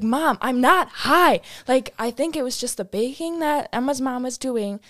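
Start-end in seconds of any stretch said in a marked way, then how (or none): 0.0-1.4 louder, slow insistent protest; insist, drawn out and pleading; pleading denial. Retold or imitated. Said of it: "And I was like".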